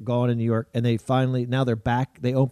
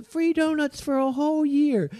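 cheerful cashier; 12 semitones higher than usual. Retold or imitated. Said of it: "she goes".

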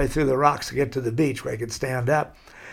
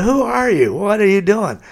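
much higher, louder, startled curiosity; a laughing surfer suddenly curious about the stranger; amazed questions; 6 semitones higher, 4 dB louder than usual. Retold or imitated. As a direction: ask it like you can't believe your eyes. Surprised stranger quizzes newcomer.

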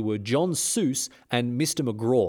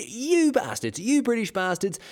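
mock furious rant; 9 semitones higher, slower than usual. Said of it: "he had a huge go at him".

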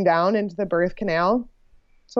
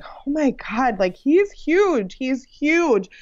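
imitating the room's amazement, drawn-out and higher; astonished reaction; 5 semitones higher, slower than usual. Everyone in the delivery room reacting to a huge newborn, astonished. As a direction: marvel like an amazed onlooker, stretching the key word.

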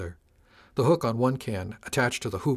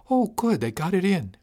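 much higher, louder burst of delight; light up at the sight; delighted chief; 9 semitones higher, 5 dB louder than usual. A narrator's reading. Chief pleased the table got installed, delighted.

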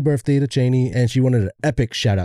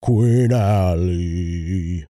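regal announcement; 4 semitones lower, much slower than usual. Pretend. Slow regal proclamation.

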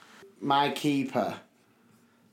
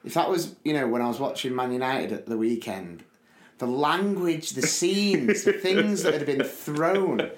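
ordinary delivery

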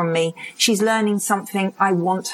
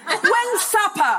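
put-on kid asking for supper; 11 semitones higher, slower than usual. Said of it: "kid asks".

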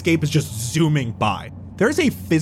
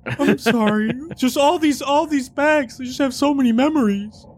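crying; 9 semitones higher than usual. Acted. NPC sobbing over his bags.

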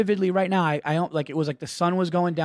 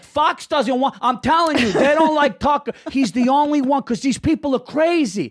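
high, worked-up dad impression; ranting dad; 9 semitones higher, 3 dB louder than usual. Son imitates dad defending Tucker, high heated rant. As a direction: build an insistent dad's political rant. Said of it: "He was like".

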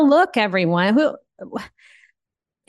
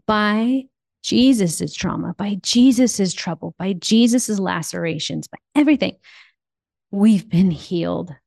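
preaching litany. Acted. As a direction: build the list like a sermon.

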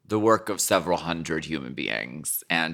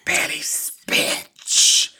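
whisper; 3 dB louder, much slower than usual. Real, not acted. Whispered aside.

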